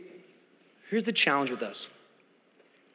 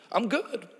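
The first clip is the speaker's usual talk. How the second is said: smug I'm good; 4 semitones higher, 7 dB quieter than usual. Pretend. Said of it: "you don't leave here going".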